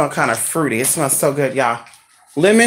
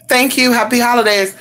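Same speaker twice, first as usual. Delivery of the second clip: mimicking the courier; 7 semitones higher than usual. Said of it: "He come knock on the door saying".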